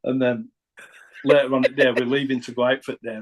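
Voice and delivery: ordinary delivery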